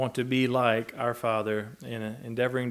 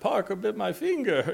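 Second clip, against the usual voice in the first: high-pitched imitation of a small child; little boy; 9 semitones higher than usual. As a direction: mimic a little kid tattling. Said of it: "little Philip on the way down here".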